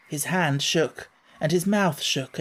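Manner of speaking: plain reading voice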